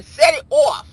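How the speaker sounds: put-on New York-accented sign-off. Shout out to [name], in a New York accent. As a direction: say it like a New Yorker. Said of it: "in my New York accent".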